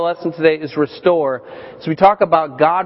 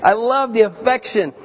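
delighted gush; 5 semitones higher than usual. Retold or imitated. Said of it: "I'm like".

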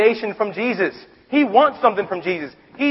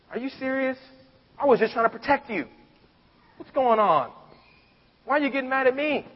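mild anger; 7 semitones higher, 4 dB quieter than usual. Retold or imitated. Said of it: "I would feel anger".

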